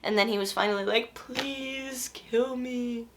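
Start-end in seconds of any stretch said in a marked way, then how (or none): 1.1-3.1 very slow, much softer, faint dying plea; weak pleading. Retold or imitated. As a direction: barely get the words out, begging. Dying Cato begging to be killed.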